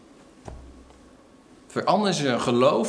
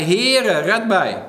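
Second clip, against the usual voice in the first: a milder call for help, loud on Heere; retelling Peter's cry, less forceful; 3 semitones higher, 5 dB louder, much slower than usual. Retold or imitated. Preacher retelling Peter's cry to Jesus, a milder call. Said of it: "riep hij".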